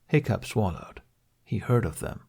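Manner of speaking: plain reading voice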